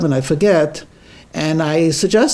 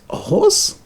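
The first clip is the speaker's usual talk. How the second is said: surprised question. Acted. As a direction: pitch leaps way up on horse, incredulous. Wife surprised at husband's plan.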